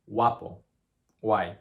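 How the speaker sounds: guapo and guay as wapo and way; soft gw. Shown as a demonstration. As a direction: drop the g into wa. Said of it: "sounds more like a wa".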